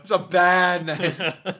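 slow words through laughter; laughing. Real, not acted. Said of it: "laughing at the name".

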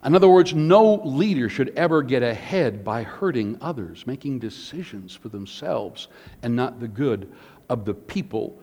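usual speaking voice

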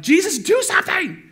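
high, loud mock-panic yell; freaking out. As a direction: yell desperately for help, hammed up. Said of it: "they freak out".

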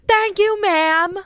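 high-pitched sarcastic thanks; angry mock thanks. Angry driver to neighbour across the street, mock thanks. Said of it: "it made me so angry".

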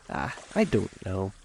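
plain reading voice

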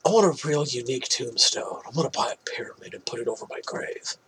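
whispered impression. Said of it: "he's like".

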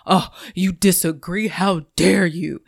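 lightly voiced huffy offence; a hint of someone taking disagreement personally; mock offended. Podcaster mocking easily offended listeners, mildly huffy. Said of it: "if I think like".

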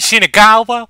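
put-on "Shinagawa?" drawled in a high, loud Texan accent. Teasing his friend's Texan-style "Shinagawa?". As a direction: echo the tourist's Texan "Shinagawa?". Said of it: "I just loved your pronunciation".

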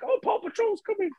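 put-on high, soft little-kid excitement; excited kid voice. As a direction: play a thrilled child, softly. Imitating excited kids.

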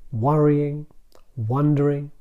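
ordinary talk, nothing marked

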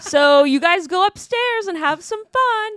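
high and loud teasing invite; flirty neighbor voice. Retold or imitated. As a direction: sell it like a party hostess. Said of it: "the hot milf mom".

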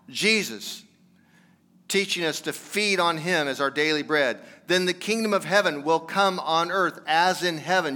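rally the congregation toward Jesus; preaching. Acted. Pastor exhorting brothers and sisters on Memorial Day, fervent preaching.

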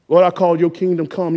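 ordinary talk

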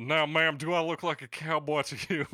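playful mock cowboy accent for his cohost. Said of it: "they make me sound like a cowboy".

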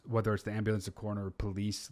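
normal talking voice

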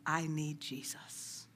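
softly and slowly quote the husband; a humble, hushed confession; quiet, slow quote. Retold or imitated. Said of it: "Like that husband said".

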